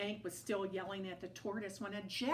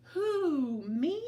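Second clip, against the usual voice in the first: loud, higher startled who; surprised owl; 7 semitones higher, 9 dB louder than usual. A narrator's reading. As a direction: hoot it in disbelief.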